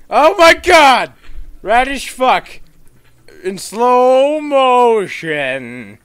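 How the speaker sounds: very high, loud, slow awed outburst; drawn-out high shriek of amazement; excited shriek. Real, not acted. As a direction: scream with delight at the slow motion.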